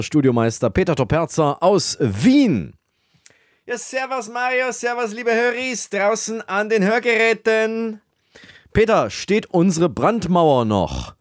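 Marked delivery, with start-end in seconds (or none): 3.7-8.0 high, thin Viennese voice greeting; high Viennese sidekick. Acted. Peter greets the listeners in a high Viennese voice. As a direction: play the Wiener sound tech Peter, high.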